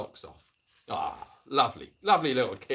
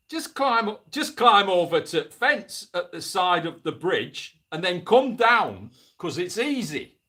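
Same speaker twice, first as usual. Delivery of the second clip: put-on louder, higher kid in a strange northern accent, slow; 5 semitones higher, 7 dB louder, slower than usual. Boy calls Mister Fullwood down to the river in a northern accent. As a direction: call up slowly in an odd northern accent. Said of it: "said this one with a strange northern accent".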